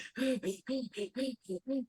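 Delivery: sharp gasp for air; showing an out-of-breath gasp; demo gasp. Shown as a demonstration. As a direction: gasp like you've just sprinted hard. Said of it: "you're like".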